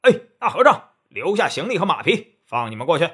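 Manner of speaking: loud rough bandit hailing monks; robber's shout. Acted. Bandit yelling at the monks to hand over their horses. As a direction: bark it out like a highway robber.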